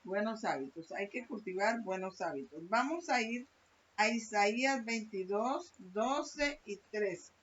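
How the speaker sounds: usual speaking voice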